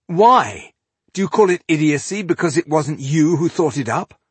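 loud, high-pitched challenge; indignant retort. A narrator's reading. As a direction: throw the accusation back at him.

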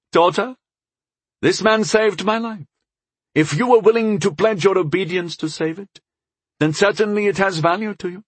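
high, lordly reprimand; stern elven father. Acted. Father reminds daughter of her obedience, stern and high-pitched. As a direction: press the point with lofty authority.

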